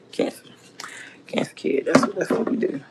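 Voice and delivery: whispered under the breath; whispered aside. Real, not acted. Muttering insult at kid.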